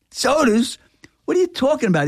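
high, incredulous scolding; can't believe what he's hearing; retold angry disbelief. Owner scolding his employee Chuck, incredulous.

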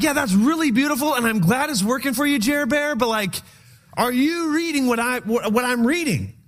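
high, teasing sarcasm with a nickname; sarcastic mock praise. Reader teasing Jeremiah about his verse, high sarcastic mock praise. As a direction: humour him, then ask if he reads the same book.

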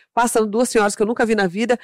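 ordinary delivery

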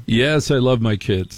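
normal talking voice

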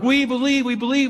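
high, excited crowd chant; eager crowd cheering. Retold or imitated. Crowd at Niagara cheering Blondin on, high and excited. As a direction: cheer it like a thrilled crowd at the falls. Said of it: "the crowds worked up and they're like".

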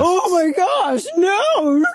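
high, drawn-out cry of dismay; slow, hammed-up howl of protest; mock horrified wail. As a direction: wail it in over-the-top horror. Mock wail at a played clip.

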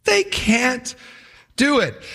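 high, broken-up mocking repeat; undermining jeer. Sanballat undermining the builders' confidence, jeering. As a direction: jeer it high, pausing after "can't". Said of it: "He undermines their confidence".

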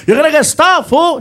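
high, loud mock warning; imitated yelling friend. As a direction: yell a warning from the back seat. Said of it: "Felipe is yelling at me".

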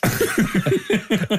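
high, amused burst of laughing; real laughter. Real, not acted. Laughing at chicken answer.

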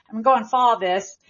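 ordinary delivery

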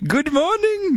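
put-on high German accent. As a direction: greet them in a German accent. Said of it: "I won't do Andrew Manson the German".

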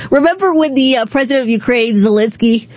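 normal talking voice

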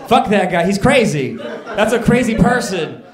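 loud, punchy mock outrage; indignant rant. Comedian writes off the man who refused him, loud indignation. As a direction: shout it dismissively. Comic rant about the sandwich guy.

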